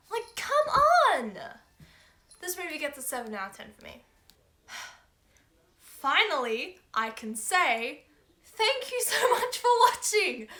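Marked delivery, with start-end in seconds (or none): 0.1-1.4 high, exasperated come on; exasperated outburst. Real, not acted. Vlogger venting that Luna barely appears, exasperated. Annoyed the character is missing. 8.4-10.5 high, giggling thanks; laughing sign-off. Real, not acted. Thanking viewers while giggling, laughing.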